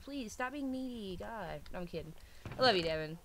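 ordinary delivery

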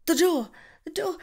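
high, bursting out loud; suddenly hearing them come home; excited realisation. Acted. Hears the lover arriving.

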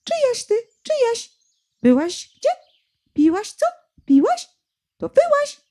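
high, calling bird voice; lapwing calling. A narrator's reading. Lapwing calling out questions, high. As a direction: call out like a lapwing. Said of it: "Czajka woła".

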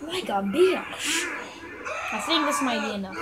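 normal talking voice

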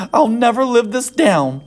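high, fretful First Lady voice; dismayed lament. Acted. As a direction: moan about the shame.

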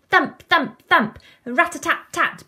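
high, loud percussive thumps; drum beats. Acted. Eleanor beating her tin, loud high drumming. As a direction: beat it out vigorously like a drum. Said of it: "beats Eleanor".

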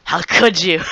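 playful accusing cry; mock betrayal. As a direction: pretend to be deeply betrayed. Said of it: "No, I'm just kidding".

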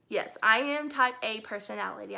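ordinary talk

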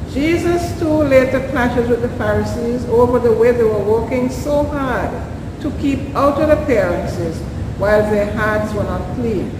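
normal talking voice

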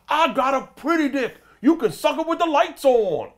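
big, loud celebrity bragging; high-pitched holler, playing to the whole club; Tracy Morgan, big and loud. Comic imitates a celebrity holding court, big and loud. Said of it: "Big, loud".

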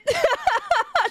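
high-pitched burst of laughter; laughing high. Real, not acted. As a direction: crack up in a high giggling run. Laughs after teasing chat remark.

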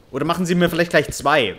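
ordinary delivery

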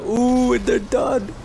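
high-pitched excited whoop; gleeful crowing. Real, not acted. Gamer watching rivals go down, high gleeful crowing. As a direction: crow it high, delighted at the knockout. Crowing over knocked-out opponents.

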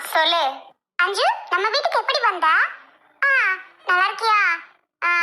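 ordinary talk, nothing marked

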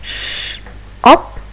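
hushed sh then a bright up; sounding out shop. Shown as a demonstration. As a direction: split "Sh-" and "up" apart. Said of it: "listen and repeat after me".

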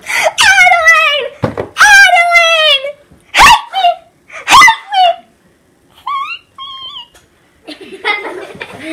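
high, loud panicked screaming; Nathan Detroit impression. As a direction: shriek for help in panic. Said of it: "my best impression of Nathan Detroit".

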